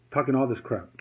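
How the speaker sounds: ordinary talk, nothing marked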